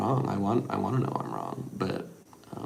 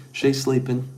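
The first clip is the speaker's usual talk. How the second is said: ordinary talk, nothing marked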